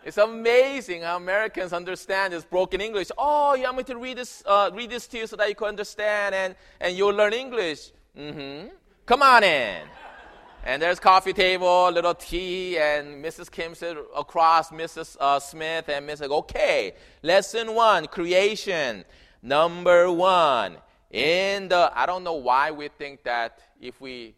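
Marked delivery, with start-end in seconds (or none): 3.2-9.9 higher, louder delighted American neighbor; the neighbor agrees to read the lesson; eager neighbor. Retold or imitated. Voicing the neighbor's eager reply. 16.3-21.9 loud, drawn-out over-clear reading; the neighbor reads the Bible lesson to Mrs Kim; very slow English. Retold or imitated. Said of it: "if we say something very slow".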